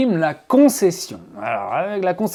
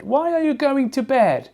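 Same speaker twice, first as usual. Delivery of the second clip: high-pitched question; 9 semitones higher than usual. Shown as a demonstration. Teacher posing the question about bedtime, high-pitched. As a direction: ask it much higher, pitch held up on WHY ARE YOU GOING.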